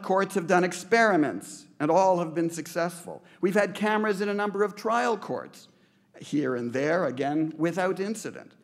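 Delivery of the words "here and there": usual speaking voice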